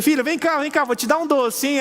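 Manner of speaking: father luring his daughter with candy; coaxing. Retold or imitated.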